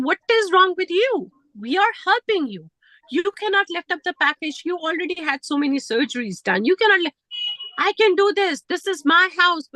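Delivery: replaying both sides of a shouting match; retold heated argument. Acted quarrel with father-in-law.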